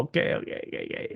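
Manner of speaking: hurried whispered compliance; meek whisper. Retold or imitated. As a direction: give in quickly under your breath. Meekly obeys being hushed.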